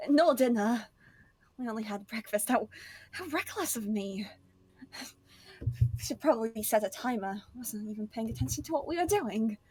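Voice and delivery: lightly voiced flustered party cook; animated in-character fretting; Chipper, chattering realisation. Plays Chipper forgetting the meals.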